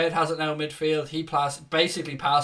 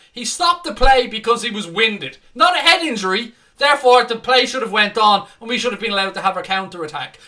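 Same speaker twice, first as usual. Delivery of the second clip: louder, higher angry rant; heated rant; 7 semitones higher, 9 dB louder than usual. Real, not acted. Fan rails at the referee's rules, heated ranting. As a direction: lay into the ref with real heat.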